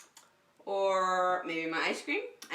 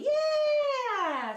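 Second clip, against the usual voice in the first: high, louder cheer; excited cheer; 4 dB louder than usual. Real, not acted. Teacher cheers the floating tugboat, excited.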